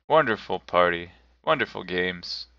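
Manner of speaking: ordinary delivery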